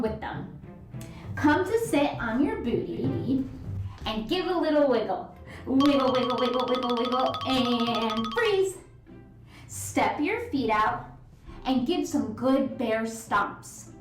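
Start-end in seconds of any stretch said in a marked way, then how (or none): none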